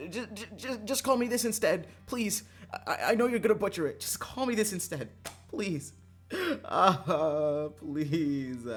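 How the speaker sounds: high, stammering mock desperation; panicked pleading. Student begging teacher not to butcher name, mock-desperate pleading.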